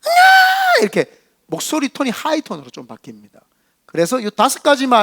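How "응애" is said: put-on sharp, much higher, louder shriek of a baby; high pain cry. Preacher demonstrating a baby in pain crying out, high-pitched. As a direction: scream "응애!" in a piercing high tone like a hurting infant. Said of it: "아프면은 톤이 엄청 올라가요".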